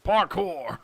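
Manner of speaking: loud cowboy yell of Parkour!; hammed-up cowboy cheer; Arthur Morgan shout. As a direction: bellow it like a rough outlaw. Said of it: "I wish I could do an Arthur Morgan accent".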